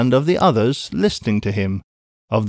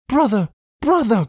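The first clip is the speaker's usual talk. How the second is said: high, plaintive wail, acted; tearful cry; 11 semitones higher than usual. A narrator's reading. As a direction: cry out high as tears come on. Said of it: "bursting into tears".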